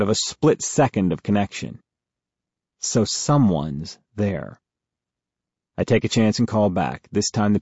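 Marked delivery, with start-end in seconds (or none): none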